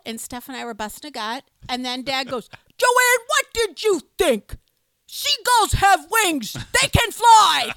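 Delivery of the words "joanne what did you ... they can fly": slow, much louder exasperated shout; Ernie impression. Dad yelling at mom in the car, mock shout. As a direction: spell it out loudly, fed up. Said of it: "You had Ernie down pat".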